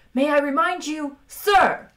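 high, loud, seething reminder; forceful, indignant hiss; angry hiss. A narrator's reading. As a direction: seethe at your commanding officer. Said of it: "hissed number two at the captain".

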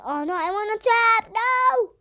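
high startled panic; panicked cry. Real, not acted. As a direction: cry out as the trap snaps. Caught in a trap.

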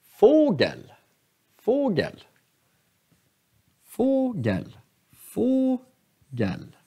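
Fågel with high stressed Få, falling gel; repeated teaching Fågel, up then down; acute accent. Shown as a demonstration. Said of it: "det här är akuta accenter".

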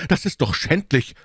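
high-pitched, indignant exclamation; shocked indignation. A narrator's reading. D'Artagnan hears of a murder plot, shocked indignation. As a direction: cry out in surprised outrage. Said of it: "Schrei der Überraschung und Entrüstung".

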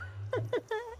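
soft, high-pitched giggle; high giggling. Real, not acted. Streamer amused at his stunt for chat, high giggling. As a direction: laugh in a small high run.

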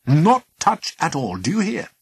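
sharp warning. Acted. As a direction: bark the warning. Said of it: "Epimetheus would reply sharply".

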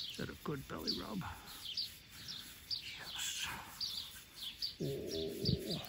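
breathy hushed pet coo. Real, not acted. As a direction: coo and hush to a happy pig. Cooing during belly rub.

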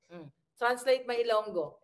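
ordinary talk

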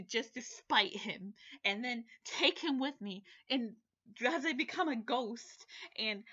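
loud, furious ranting outburst; half-joking furious rant at the book's love interest; seething anger. Real, not acted. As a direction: let the anger boil over at the character. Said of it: "anger seething".

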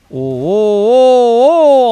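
loud, rising excited hoots; amazed cheering. Real, not acted. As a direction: cheer at an amazing play. Watching a great play. (yüksek sesle hayranlık nidası)

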